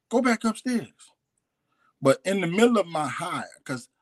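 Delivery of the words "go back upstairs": retold insistent order. Repeats order as nephew stays put.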